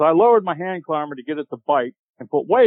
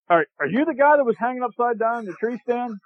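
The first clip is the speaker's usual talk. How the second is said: teasing local; 9 semitones higher than usual. Retold or imitated. Townsfolk teasing question.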